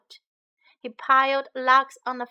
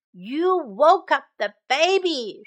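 higher, louder mother's rebuke; growled scolding; 4 semitones higher, 6 dB louder than usual. Acted. Mama Bear scolding visitors, mildly growled. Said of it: "she said, and growled".

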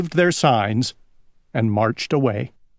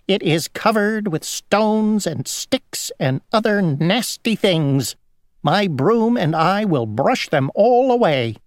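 lightly voiced, higher-pitched fussy fly; fussy housefly; 7 semitones higher than usual. A narrator's reading. Housefly to Grasshopper about the dirty road, high fussy complaint. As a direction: fuss in a slightly raised little voice.

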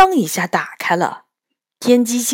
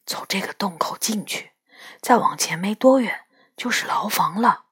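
hushed breathy whisper; 8 dB quieter than usual. Acted. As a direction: whisper so no one hears. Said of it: "他低声说".

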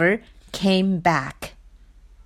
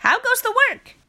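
higher mocking call; farmer calling; 14 semitones higher, much faster than usual. A narrator's reading. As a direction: call it out sing-song. Farmer calls out.

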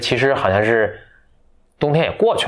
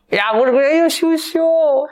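put-on coy bashful girl; 3 dB louder than usual. Telling friend a fairy tale, hammy shy girl. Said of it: "你戏太多了".

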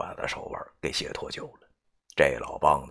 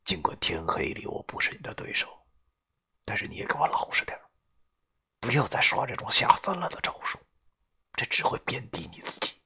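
cold, hushed warning; young man coldly warning the older man, quiet; 6 semitones higher, 4 dB quieter than usual. A narrator's reading. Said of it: "冷声地对他说道".

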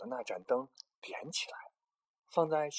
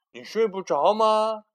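much louder, higher question; loud call; 7 semitones higher, 11 dB louder, slower than usual. Acted. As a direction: call out to the cub. Big Bear asks little bear.

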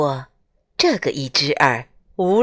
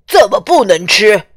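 self-righteous; 7 dB louder than usual. Acted. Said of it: "大象还很理直气壮".

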